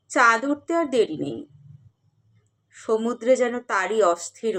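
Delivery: measured, expressive verse reading with long pauses; dramatic recital with rising and falling cadences; poetry recitation. Acted. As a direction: recite the poem phrase by phrase, letting line endings sink. Recites a poem.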